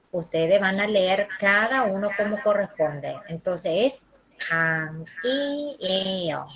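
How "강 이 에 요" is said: 강, 이, 에, 요 one syllable at a time, ng kept; as it sounds. Shown as a demonstration. Teacher showing students each syllable, read as written. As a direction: separate 강, 이, 에, 요 evenly. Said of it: "Se pronuncia así como suena".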